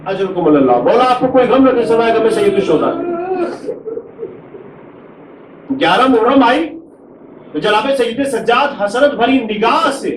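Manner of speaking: keen the tragedy aloud to draw the mourners to tears; masaib lament. Acted. Preacher to mourners at a majlis, high wailing lament.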